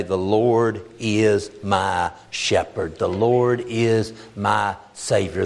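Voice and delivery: slow, each word weighed, stress on "Lord"; slow emphatic declaration. Shown as a demonstration. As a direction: declare "The Lord is my shepherd" slowly and firmly with "Lord" leaned on. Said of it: "undeniably, unmistakably be able to say".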